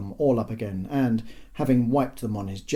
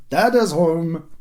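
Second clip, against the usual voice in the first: loud, high announcement from dad; calling out; 7 semitones higher, 9 dB louder, slower than usual. A narrator's reading. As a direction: holler your arrival to the children. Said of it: "he called".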